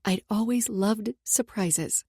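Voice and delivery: ordinary delivery, nothing marked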